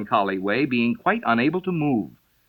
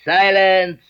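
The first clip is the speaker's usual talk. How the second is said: loud royal call for silence; king calling out; 5 semitones higher, 7 dB louder than usual. A narrator's reading. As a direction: bark it across the court. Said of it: "called out".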